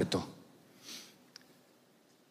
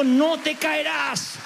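high, slow comforting words; slow reassuring promise; 8 semitones higher, slower than usual. Acted. Preacher voices God reassuring the believer, slow and high. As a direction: speak God's promise slowly.

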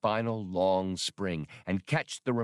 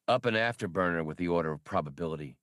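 plain reading voice

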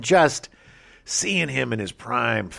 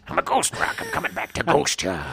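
higher, raspy trainer voice; Mickey; 6 semitones higher than usual. Acted. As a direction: rasp to your boxer like a comic ghost coach. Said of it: "he played Mickey, Rocky's trainer".

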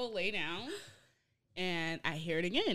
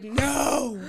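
loud, raised outcry, retold; loud disbelief; 5 semitones higher, 13 dB louder than usual. Student realising what roommate is doing, loud retold protest. Retelling shocked refusal to believe it.

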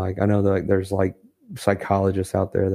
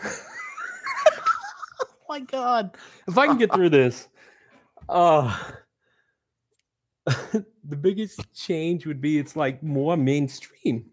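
laughing high and slow, barely getting the words out; trying to answer while cracking up; laughing through it; 8 semitones higher, slower than usual. Real, not acted. Guest laughing through his hammed-up Canadian answer. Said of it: "I'm going to enjoy this".

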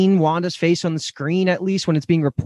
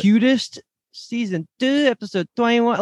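imitated Sokovian child accent; 8 semitones higher than usual. Fan mimicking young Wanda picking a sitcom episode, cute Sokovian accent. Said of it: "however she said it ... The accent was so good".

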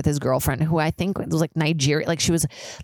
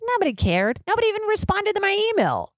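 high, outraged wail about being ignored; indignant high whine. Real, not acted. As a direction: squeal it like a wronged actor. Indignant complaint, pitch jumps.

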